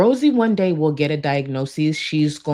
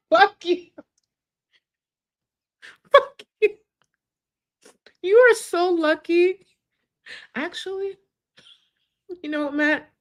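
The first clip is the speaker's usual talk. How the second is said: very high, squeaky through laughter; helpless laughing protest; 13 semitones higher than usual. Real, not acted. Streamer cursing Matt for the joke, cracking up.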